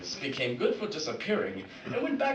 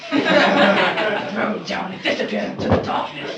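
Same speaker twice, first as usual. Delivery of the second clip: loud, raised proclamation; dramatic exit; 5 semitones higher, 11 dB louder than usual. Acted. Batman leaving the janitor man behind, loud and dramatic. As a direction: proclaim it loudly like a superhero exit.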